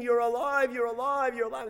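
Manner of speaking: high, loud cries of relief; screaming. Retold or imitated. Parents seeing their son alive after the bombing, screaming. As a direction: scream it in joyful disbelief. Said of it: "they start screaming".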